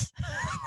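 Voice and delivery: a sudden high squeal of laughter; high squeaky laugh. Real, not acted. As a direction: burst out with a squeaking giggle. Laughing at dad's answer.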